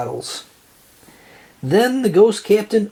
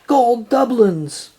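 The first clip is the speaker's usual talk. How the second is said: excited cry; 7 semitones higher, slower than usual. A narrator's reading. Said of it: "SpongeBob cried".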